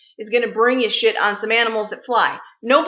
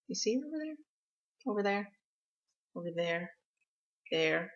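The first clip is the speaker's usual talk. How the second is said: coaxing a pet; 3 semitones lower, 14 dB quieter than usual. Real, not acted. Pointing out to pet bird.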